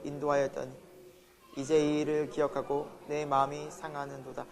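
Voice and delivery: low, even congregational reading; scripture reading. Acted. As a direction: read it out in measured unison phrasing, low in pitch. (낮고 고르게 읽는 성경 낭독)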